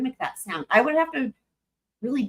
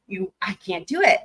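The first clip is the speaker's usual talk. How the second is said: ordinary talk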